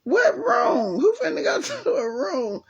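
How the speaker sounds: high incredulous cry; retold disbelief. Retelling her reaction to the doctor about staying overnight: high, incredulous outcry. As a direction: toss the question up in shocked disbelief. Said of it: "So I'm like".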